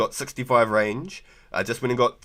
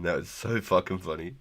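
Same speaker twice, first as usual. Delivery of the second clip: ordinary talk, nothing marked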